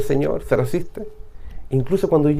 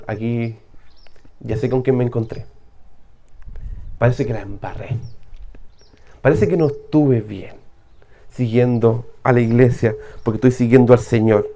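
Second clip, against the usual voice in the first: fearful confession; 7 semitones lower, 3 dB quieter than usual. Acted. Paul trembling, afraid.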